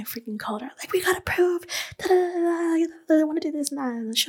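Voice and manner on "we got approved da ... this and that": high-pitched excited gush; retold screaming. Daughter telling mom they got approved, retold excited squeal. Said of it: "I was screaming".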